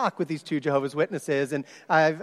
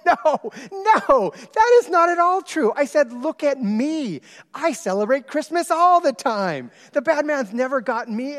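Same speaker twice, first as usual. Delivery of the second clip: much higher and louder, emphatic no; eager reassurance; 11 semitones higher, 6 dB louder than usual. Retold or imitated. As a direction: sweep their fear away brightly. Reassuring the frightened girls.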